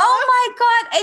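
mortified. Retold or imitated. Mortified on waking at work.

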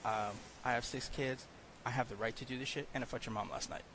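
soft, understated boast; deadpan comeback. Acted. Co-host's comeback to a younger heckler, quiet deadpan. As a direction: answer quietly and matter-of-factly. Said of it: "Or you just go".

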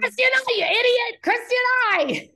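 high, loud exasperated shouting; mock furious yell. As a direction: bellow it like a furious teacher correcting. Quoting Abby's yelled correction.

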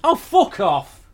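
high, loud, slow drawn-out blast; furious shout. Acted. Telling Randall to get lost, slow, high and very loud. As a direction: stretch the insult into a howl. Final outburst at roommate.

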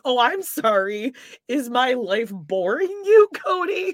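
slow, high sarcastic apology; acted indignation. Mock-confronting Cody over boredom, slow sarcastic indignation. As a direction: stretch it out, offended, leaping up on the name. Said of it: "I'd be like".

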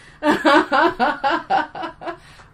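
high, loud burst of laughter; hearty laugh. Real, not acted. Laughs after lost t-shirt joke.

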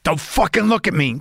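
cat doing a Dennis Hopper threat. Retold or imitated. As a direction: channel Hopper's psycho menace in Blue Velvet. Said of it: "He's like a little, uh, Dennis Hopper in Blue Velvet".

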